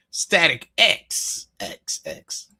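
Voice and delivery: slow, stretched name trailing into whispered echoes; mock stadium echo on the band name. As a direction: make the name ring out like a reverb tail.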